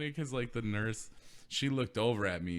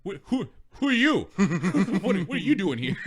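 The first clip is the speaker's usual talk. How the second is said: startled cop; 7 semitones higher, 10 dB louder than usual. Retold or imitated. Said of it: "So he's just like".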